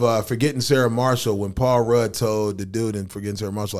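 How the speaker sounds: normal talking voice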